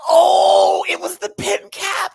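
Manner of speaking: high excited shout of discovery; screamed realization. Retold or imitated. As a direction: shriek as it clicks. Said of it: "I screamed".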